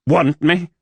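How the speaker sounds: clipped. A narrator's reading. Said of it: "he clipped out".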